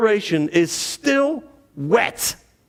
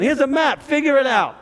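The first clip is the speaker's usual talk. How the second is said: put-on New York accent; 6 semitones higher than usual. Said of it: "with that great New York accent".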